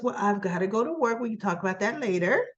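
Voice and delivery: fast, rushed take on Ashley leaving; hurried brush-off. Retold or imitated. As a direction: rattle it off on the way out. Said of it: "And so she goes".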